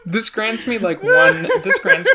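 giggling through the words; laughing. Real, not acted. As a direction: talk while still laughing. Giggling after being teased.

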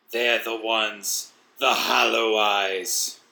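slow, drawn-out monster voice; wheezing creature. Acted. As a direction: reveal it slowly in a creature's rasp. Creature answers the narrator.

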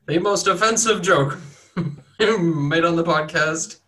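loud showman announcement; mock announcer. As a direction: present it like a ringmaster.

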